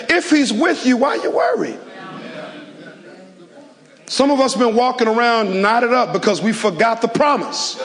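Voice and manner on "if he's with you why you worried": high, pressing "why you worried"; preaching shout. Acted. Pastor challenging the worried listeners, in a high shout. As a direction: push it out high and hard.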